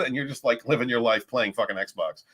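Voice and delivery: usual speaking voice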